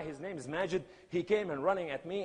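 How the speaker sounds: normal talking voice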